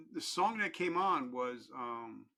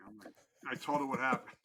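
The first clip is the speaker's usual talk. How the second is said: ordinary delivery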